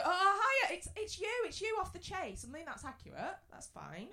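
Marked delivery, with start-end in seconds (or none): 0.0-2.5 high-pitched starstruck greeting; imitated high, excited recognition; gushing fan. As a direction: squeal it like a fan spotting a celebrity. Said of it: "So they go".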